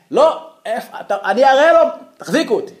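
hammed-up hold-me-back bluster; mock blustering threat. Man demanding who pushed him.

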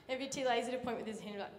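normal talking voice

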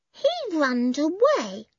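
put-on high, slow little-boy voice; lightly voiced toddler; small boy. A narrator's reading. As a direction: say it like a small child. Little boy Benny speaks.

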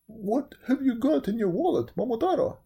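high put-on dog character. A narrator's reading. Dog asking about the wallet, high dog voice. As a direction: ask it high, as a storybook dog.

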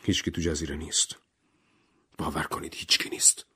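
hushed, shaken insistence; low-volume, tremulous near whisper; trembling voice. A narrator's reading. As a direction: plead softly, voice nearly gone to a whisper. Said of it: "با صدای لرزان گفت".

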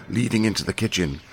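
ordinary delivery, nothing marked

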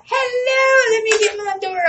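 high loud call of hello; bright welcoming hello; loud greeting. Real, not acted. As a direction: call out warmly to the door. Greeting guests arriving live.

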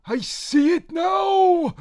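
high, slow, loud shriek of a vision; shrieking witch. Acted. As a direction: cry out a prophecy. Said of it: "suddenly shrieked".

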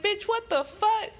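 high-pitched exasperated disbelief; incredulous retort. Retold or imitated. Retort to claim about pain.

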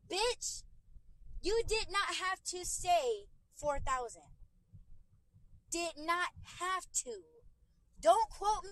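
high, loud, furious telling-off; loud, high indignant rant, slow on did not have to; angry retort. Retold or imitated. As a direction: blast her for quoting a wrong price. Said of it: "that's what got me mad at first".

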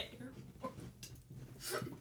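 slow whisper. Acted. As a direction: whisper it slowly. Continuing the whisper joke.

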